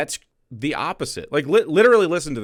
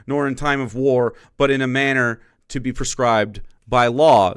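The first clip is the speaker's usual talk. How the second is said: reading the amendment aloud; 5 dB louder than usual. Shown as a demonstration. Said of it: "The Second Amendment reads".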